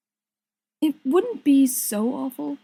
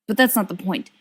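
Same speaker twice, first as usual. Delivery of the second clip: ordinary delivery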